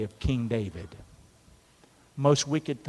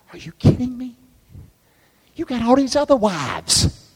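high incredulous scoff; voicing David; 10 semitones higher than usual. Preacher voicing King David scoffing, high and disbelieving. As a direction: sneer at the idea of being denied.

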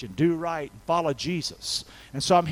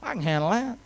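calm, dismissive ease. Retold or imitated. God answers someone's cry for help, calmly unbothered. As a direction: puff it off casually. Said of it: "Isn't that calm? Isn't that, it's like".

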